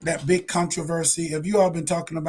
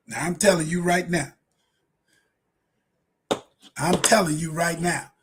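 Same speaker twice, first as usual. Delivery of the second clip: ordinary talk, nothing marked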